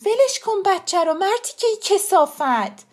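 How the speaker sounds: high, loud furious cry; screaming. A narrator's reading. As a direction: scream to free a child from attack. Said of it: "فریاد زدم". (فریاد بلند و خشمگین)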